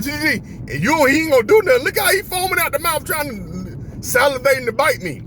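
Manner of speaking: high, frantic plea to a cousin; panicked warning. Retold or imitated. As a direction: warn her in a rising panic. Retold frantic warning about the dog.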